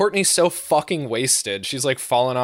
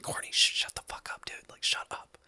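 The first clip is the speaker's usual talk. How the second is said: hissed, urgent whisper; 11 dB quieter than usual. Retold or imitated. To drunk Courtney at the strip club, an urgent whisper. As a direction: shush her without being heard.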